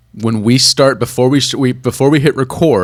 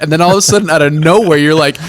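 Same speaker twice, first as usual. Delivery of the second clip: ordinary talk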